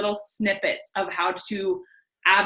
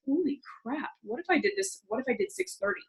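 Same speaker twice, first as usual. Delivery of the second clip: soft, higher astonished self-talk; hushed amazement; 8 semitones higher, 9 dB quieter than usual. Retold or imitated. Woman retelling her morning discovery to herself, hushed amazement. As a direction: marvel softly as an idea dawns.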